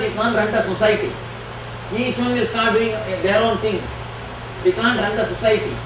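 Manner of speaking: ordinary delivery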